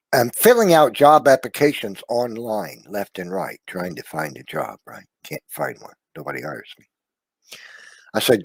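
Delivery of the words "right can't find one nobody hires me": ordinary talk, nothing marked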